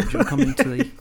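mock sleazy teacher; creepy teacher voice. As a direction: lure the kid in, hammed up. Acts creepy teacher invitation.

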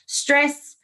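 put-on light thick Ukrainian accent; a hint of a Ukrainian dad. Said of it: "in this very thick Ukrainian accent".

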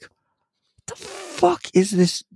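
high, slow wondering voice; awestruck child. Retold or imitated. Toddler seeing a sparrow, awestruck. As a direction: gape like a toddler. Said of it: "watch my son go like".

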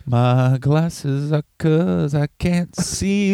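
slow, put-on nerdy voice; slow mocking voice. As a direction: drag it out mockingly. Mocking cohost's line.

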